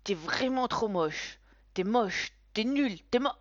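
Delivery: put-on taunting boy. A narrator's reading. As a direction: keep taunting until it chokes. Boy repeats insults. (enfant qui répète ses moqueries)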